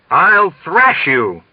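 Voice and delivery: pompous officer's fuming threat; furious threat. A narrator's reading. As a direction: drag the threat out in outrage.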